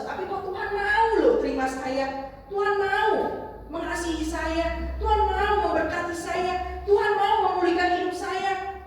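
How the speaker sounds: high-pitched, earnest wonder; amazed. Acted. Sinner praying to God about being accepted, in high-pitched amazement. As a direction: marvel at an undeserved welcome, voice lifted.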